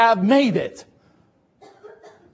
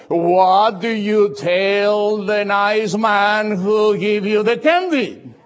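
slow, pointed prompting from a mother, louder; embarrassed mother's voice; 5 dB louder, much slower than usual. Retold or imitated. A mother coaxing her child to thank the nice man, slow and pointed. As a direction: drag each word out like a mother nudging her child. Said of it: "she said in a different voice".

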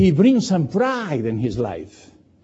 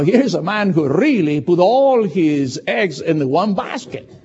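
ordinary delivery

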